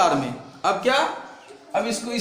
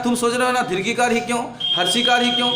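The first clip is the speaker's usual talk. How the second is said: ordinary delivery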